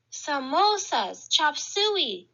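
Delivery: plain reading voice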